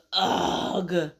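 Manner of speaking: long, drawn-out "ugh"; exasperated groan. Acted. Fed-up friend answers the caterpillar, exasperated groan. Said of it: "And this guy says".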